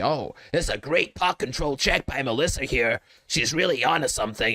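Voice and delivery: high, croaky poker commentator impression. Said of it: "I keep doing that, that croaky voice".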